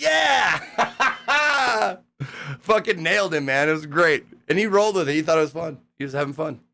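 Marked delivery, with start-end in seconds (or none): 0.0-2.0 high laughing shout of triumph; celebrating a joke that landed; excited yell. Retold or imitated. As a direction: whoop it out through a laugh. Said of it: "And I was like".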